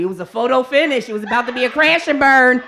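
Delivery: ordinary delivery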